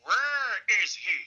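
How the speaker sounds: high, loud, drawn-out call; booming impersonation, words stretched long; Nelson Mandela. Acted. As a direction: call out slowly from another room. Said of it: "and then I heard a".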